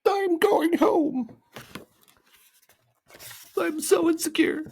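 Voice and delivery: very high, loud hammed-up wail; hysterical crying. A narrator's reading. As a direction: sob hysterically as a little creature. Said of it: "crying hysterically".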